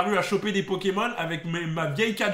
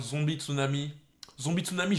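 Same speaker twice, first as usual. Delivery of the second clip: normal talking voice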